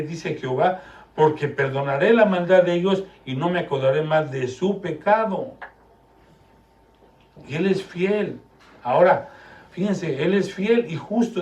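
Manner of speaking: loud, high, fervent sermon delivery; preaching at full force. Acted. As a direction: preach it hard to the congregation.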